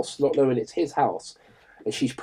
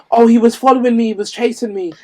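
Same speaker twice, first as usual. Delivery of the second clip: mock frightened woman; 10 semitones higher, 6 dB louder than usual. Imagined false excuse afterwards.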